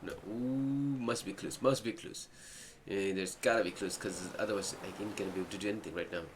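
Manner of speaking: ordinary talk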